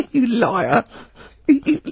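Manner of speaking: high-pitched, giggling disbelief; laughing accusation. Real, not acted. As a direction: call him out while cracking up. Laughing at Carl's culture claim.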